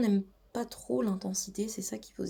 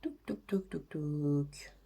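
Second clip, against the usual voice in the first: imitated clacking; 4 semitones lower, slower than usual. Imitating the lift chain.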